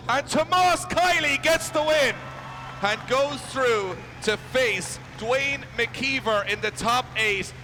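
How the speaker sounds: high, loud, slow winner call; excited announcement. Real, not acted. Announcing the result.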